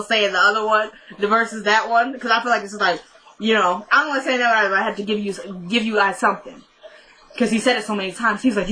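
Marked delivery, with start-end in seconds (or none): none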